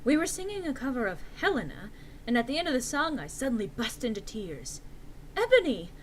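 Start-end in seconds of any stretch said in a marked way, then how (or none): 5.4-5.9 concerned friend. A narrator's reading. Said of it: "asked in a concerted voice".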